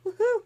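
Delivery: high, loud woohoo; excited whoop. Real, not acted. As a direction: whoop it out in celebration. Cheers about event coming to Dallas.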